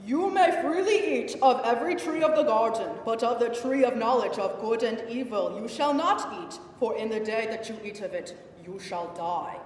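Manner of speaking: loud, declamatory divine decree; booming commandment, easing at the end; commanding God. Acted. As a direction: proclaim it like God laying down the law, then settle on the last words. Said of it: "So when God told her".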